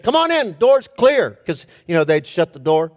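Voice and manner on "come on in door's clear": high, loud call. Retold or imitated. Ghost calling teammates into the room, loud call. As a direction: bait them in with a bright shout. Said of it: "opened the door for him and said".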